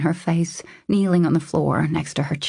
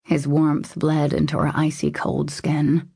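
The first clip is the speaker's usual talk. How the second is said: plain reading voice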